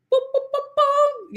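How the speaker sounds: very high sing-song boops; high mock sound effect; playful booping. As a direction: make little high beeps, holding the last. Playful wrong-answer noise before correction.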